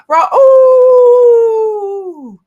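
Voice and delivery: long, high, loud drawn-out call of a name; imitating Dee Dee. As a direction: holler the name like Dee Dee calling across the house. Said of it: "I sound like Dee Dee, don't I?".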